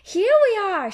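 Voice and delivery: high, loud happy shout; cheerful little-girl shout. A narrator's reading. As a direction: shout it out proudly. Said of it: "shouts Peppa".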